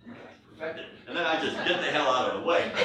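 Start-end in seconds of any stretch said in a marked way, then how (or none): 0.1-0.9 conspiratorial whisper. Retold or imitated. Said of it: "I come over to Scottish whiskey and I say".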